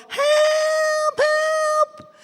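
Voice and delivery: high shout for help; high piercing call for help. Acted. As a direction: cry out high and urgent. Mice calling out for help.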